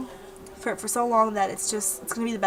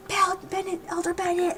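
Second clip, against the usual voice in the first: high mock call for attention; imitated calling out; 7 semitones higher, 3 dB quieter than usual. Man interrupting the ceremony to call Elder Bennett, high imitation.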